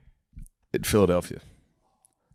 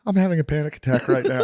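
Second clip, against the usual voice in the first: higher panicky voice; 8 semitones higher, 3 dB louder than usual. Retold or imitated. Co-star's panic quoted.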